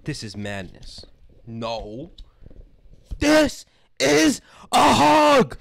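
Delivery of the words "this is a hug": very high, loud, slow battle cry; hammed-up warrior shout; Sparta-style yell. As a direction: roar each word separately and drawn out. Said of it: "you have to, like, almost yell it".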